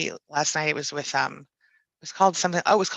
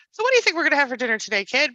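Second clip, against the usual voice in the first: put-on higher, fast, chatty talk to a baby; chatty parent voice; 8 semitones higher, faster than usual. Mother chats with her baby in the grocery store, higher and quick. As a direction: chat with the baby like an adult. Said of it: "I talk to my children like adults".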